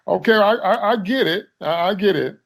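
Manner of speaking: fed up, I get it already; exasperated. Retold or imitated. Exasperated at a heavy-handed scene.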